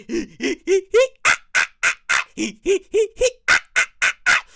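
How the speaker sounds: high cackling hee hee ah ah; mocking sister laughter. Sisters laughing at him, high mocking cackle. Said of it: "my sisters will be sitting there laughing".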